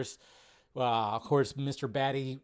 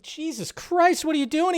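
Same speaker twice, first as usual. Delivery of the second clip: shocked exclamation; 13 semitones higher, 4 dB louder than usual. Retold or imitated. Viewer aghast at Ethan Hawke's character on screen. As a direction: gasp it out in disbelief, pitched way up. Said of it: "I was like".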